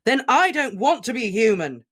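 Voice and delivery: furious roar. Acted. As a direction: roar it in fury. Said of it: "Harry roared".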